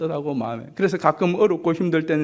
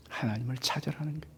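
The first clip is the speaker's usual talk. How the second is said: whisper; 3 semitones lower, 13 dB quieter than usual. Acted. Whispered exhortation.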